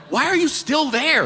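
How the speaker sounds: high-pitched, exasperated disbelief; incredulous outburst. Retold or imitated. Comic reacting to friend staying put, incredulous high cry.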